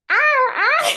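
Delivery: very high, loud mock scream; imitating a high-pitched scream. Cousin squealing as he gets sucked under, mock scream. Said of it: "he started screaming, like, very high pitched".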